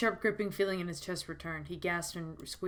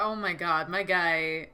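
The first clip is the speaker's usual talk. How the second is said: ordinary talk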